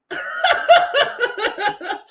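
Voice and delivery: loud high-pitched laughter; a loud, high burst of laughter. Real, not acted. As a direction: crack up at your own joke. Laughing at own story.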